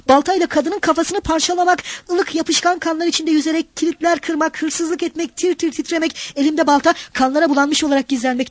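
high, tense list of horrors; picture each act with rising dread; horrified trembling. A narrator's reading. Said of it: "Bunları söylerken tir tir titriyordu".

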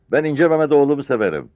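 put-on loud, high, defiant old man; roaring declaration. A narrator's reading. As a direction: proclaim it at full voice. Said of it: "beklenmeyen bir sesle gürledi". (gürleyerek, meydan okurcasına)

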